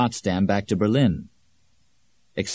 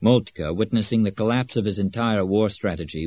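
usual speaking voice

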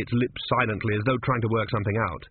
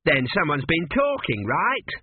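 higher, pressing suspicion; accusing; 6 semitones higher than usual. A narrator's reading. The Sphinx suspects someone gave the answer away, accusingly. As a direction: push for a confession.

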